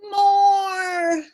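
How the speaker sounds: high loud gleeful cry; cried out. A narrator's reading. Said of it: "cried the baby".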